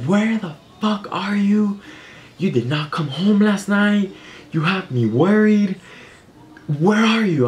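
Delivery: high-pitched, slowed furious mom impression; mock angry mom. Mom on phone to daughter out all night, high mock yell. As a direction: play mom losing it on the phone.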